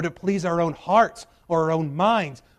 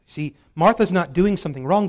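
usual speaking voice

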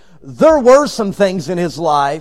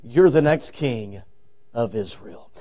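slow, low, soft and confidential; whispering in an ear; 6 semitones lower, 5 dB quieter than usual. Acted. Old Samuel leaning to David's ear, hushed. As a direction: sink to a hush, with pauses. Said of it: "leans over and whispers in David's ear".

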